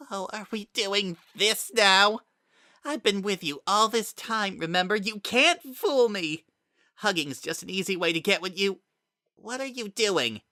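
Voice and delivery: mocking Kara. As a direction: sneer at the hug.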